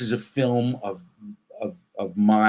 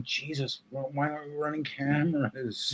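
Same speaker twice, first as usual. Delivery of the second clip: softer, higher mock-exasperated complaint; retold frustration; 4 semitones higher, 9 dB quieter than usual. Comedian recalling unfilmed live shows, mildly acted frustration. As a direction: replay your exasperation under your breath, lightly acted. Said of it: "I would get so frustrated".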